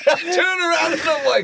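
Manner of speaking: loud, high, laughing disbelief; laughing shout. Real, not acted.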